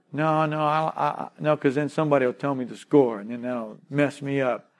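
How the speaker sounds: low, soft, hesitant protest; mock excuse-making. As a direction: lightly act someone wriggling out of it. Acted excuse for skipping church.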